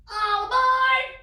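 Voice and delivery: loud, high boarding call. Acted. Oscar to the dogs climbing on, loud call. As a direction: call it out to everyone. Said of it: "Oscar called".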